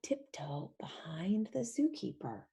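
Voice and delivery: hushed tiptoe whisper. Acted. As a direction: whisper it like sneaking past someone. Sneaking past the zookeeper.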